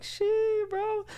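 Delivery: very high falsetto disbelief; stunned squeak. Retold or imitated. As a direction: squeal it under your breath. Retold stunned reaction.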